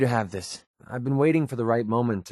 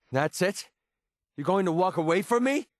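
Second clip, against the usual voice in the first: high, rising incredulous questions; indignant disbelief; 7 semitones higher than usual. Acted. To a companion walking away, indignant. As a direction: challenge them as they turn away. Acted quarrel, other walks away.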